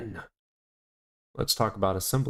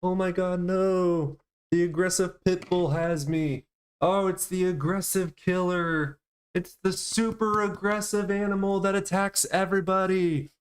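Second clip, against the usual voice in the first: playful hammed-up panic, higher; mock terror; 7 semitones higher than usual. Streamer teasing his lap dog with mock terror. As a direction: pretend the dog is a killer.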